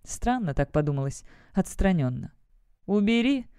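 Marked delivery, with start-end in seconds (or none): 2.9-3.5 commanding. A narrator's reading. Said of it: "велела Октавия".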